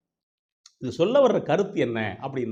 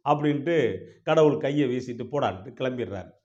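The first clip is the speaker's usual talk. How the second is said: plain reading voice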